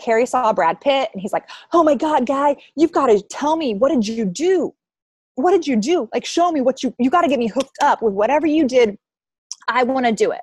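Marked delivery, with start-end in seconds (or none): 1.7-10.4 higher-pitched, insistent retold outburst; imitating starstruck excitement. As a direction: plead like a fan who just met a star. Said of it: "Harry flipped out".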